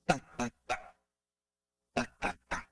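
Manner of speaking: soft, fading "tá" beats, the second set whispered; rhythm syllables. Shown as a demonstration. Teacher tapping a rhythm softly, then in a whisper. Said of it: "Tem uns que estão no ritmo assim".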